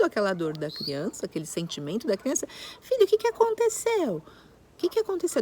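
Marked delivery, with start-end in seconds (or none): 2.8-5.4 caring parent. Shown as a demonstration. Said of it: "ao invés de".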